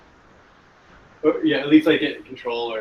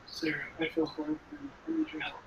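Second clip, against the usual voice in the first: fake sick voice; 13 semitones higher, 13 dB quieter than usual. Pretending to be sick for distraction.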